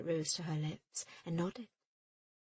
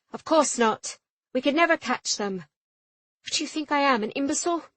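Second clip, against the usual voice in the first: louder and higher, heated; captain rages at her mate; furious retort; 6 semitones higher, 10 dB louder than usual. A narrator's reading. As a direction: bite off each line.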